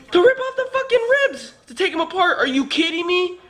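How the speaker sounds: high-pitched incredulous exclamation; exasperated, indignant rant; retold pissed-off outburst. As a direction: relive your outrage, voice shooting up. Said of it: "I was pissed".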